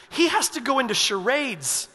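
ordinary talk, nothing marked

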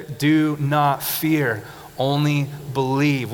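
drawn out, every word weighted; slow, loud congregation recital. Shown as a demonstration. Preacher leading the church in a slow, loud recital. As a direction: lead the room in saying it slowly. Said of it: "Can we say that together?".

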